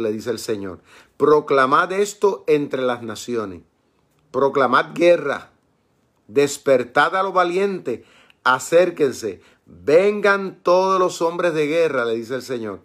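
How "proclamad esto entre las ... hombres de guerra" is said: slow, ringing Bible reading; scripture proclamation. Acted. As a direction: proclaim it slowly, like a summons to battle. Reads scripture aloud as a proclamation. (lectura bíblica lenta y solemne, como proclama)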